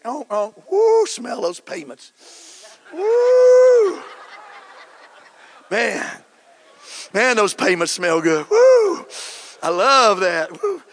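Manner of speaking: squealing high whoops over the new car smell; hammed-up glee, sniffing the new car; excited whoops. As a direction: gush and whoop with mock delight at the car. Pastor hams up smelling new car.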